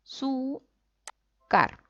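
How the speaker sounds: a, su, car with a pause after each syllable. Shown as a demonstration. Teacher splitting the word for students, syllable by syllable. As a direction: break azúcar into separate a... su... car. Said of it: "¿Dónde cargamos la voz?".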